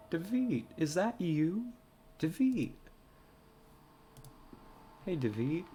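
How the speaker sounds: high, loud call across a distance. Acted. Searching for David, calling out loudly. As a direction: shout his name to find him.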